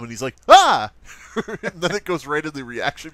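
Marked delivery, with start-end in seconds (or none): 0.5-0.9 short, loud, high mock scream. YouTuber reacting to Vader's reveal, loud mock scream. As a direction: let out a hammed-up reaction scream.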